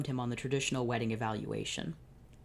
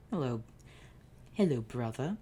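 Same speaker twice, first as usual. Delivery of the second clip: lisped voice; 3 dB quieter than usual. Acted. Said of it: "recognized the lisped voice".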